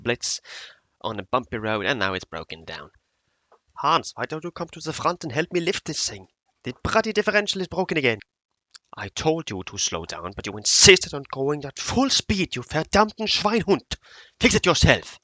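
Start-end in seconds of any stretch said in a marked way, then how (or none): 3.8-8.2 hammed-up German accent, getting louder and faster; a stranded German soldier calling his mate in a put-on German accent. Stuck truck driver calls Hans to the front, German accent. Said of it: "accents are not my cup of tea". 9.0-15.2 put-on German accent building from grumbling to a loud shout; hammed-up German accent, yelling the blame at his mate; angry German accent. Fritz blames Hans for the breakdown, angry German accent shout. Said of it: "accents are not my cup of tea".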